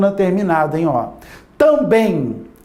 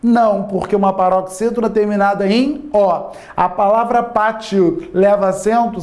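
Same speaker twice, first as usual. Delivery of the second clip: usual speaking voice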